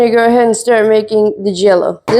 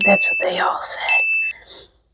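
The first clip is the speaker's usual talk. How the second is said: slow, secret whisper to finish the joke; whispered punchline; 8 dB quieter, much slower than usual. Pretend. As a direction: drop to a slow whisper for the tag line.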